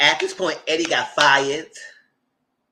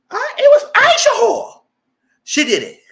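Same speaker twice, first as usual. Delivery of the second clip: high crying wail of a panicked snitch; shrill snitching squeal; 11 dB louder than usual. Retold or imitated. Panicked man snitching on Aisha, crying high wail. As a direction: squeal out the name like a caught man.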